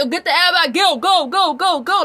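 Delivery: hammed-up military yelling; mock yell. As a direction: bark it like a drill sergeant, imitating. Bouncers yelling at women.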